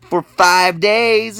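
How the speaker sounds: ordinary delivery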